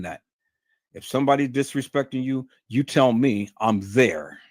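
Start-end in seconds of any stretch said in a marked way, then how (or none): none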